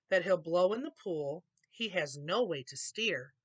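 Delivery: ordinary delivery, nothing marked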